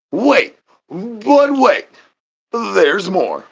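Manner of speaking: high, slow hammed-up ad voice; infomercial pitch. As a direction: oversell it like a TV ad. Infomercial parody.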